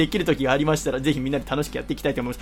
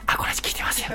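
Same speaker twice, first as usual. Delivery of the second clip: imitated fan whispering a secret; soft, voiceless murmur; hushed voice; 5 dB quieter than usual. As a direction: whisper it softly, as if confiding. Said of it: "そして小声で".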